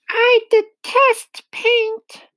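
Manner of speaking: very high, slow squeaky spider voice; high-pitched Miss Spider, full of distaste; Miss Spider announcing. A narrator's reading. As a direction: announce it slowly in a tiny high voice. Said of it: "Miss Spider announced".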